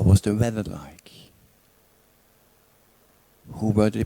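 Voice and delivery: calm, unhurried meditation guidance with long pauses; guided meditation. Real, not acted. Teacher guides listeners back through a memory, calm meditation voice. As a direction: lead them inward gently, leaving silence between phrases.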